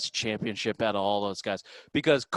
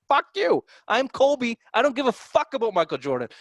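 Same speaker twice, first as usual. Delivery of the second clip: mock Kobe defiance; 10 semitones higher than usual. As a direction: toss it off like a brash star who doesn't care. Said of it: "He's like".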